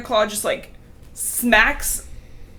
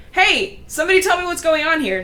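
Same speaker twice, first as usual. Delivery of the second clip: higher, pushier mock demand; Tiger Claw demanding; 8 semitones higher, 4 dB louder, faster than usual. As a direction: barge in and demand answers. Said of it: "and he's like".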